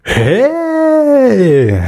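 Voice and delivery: high excited cry of hey; sudden mock-cheering shout. Real, not acted. Cheering at [name] name.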